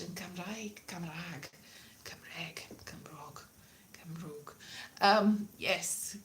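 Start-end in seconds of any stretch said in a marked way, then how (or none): 0.0-4.5 very soft, low repetitions; fading mutter. Pretend. Comic trying out Welsh words, dwindling to whisper.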